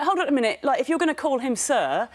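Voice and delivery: acted-out demand for equal treatment; indignant objection. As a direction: object as if pulling up a shop assistant. Voices her objection at the shop.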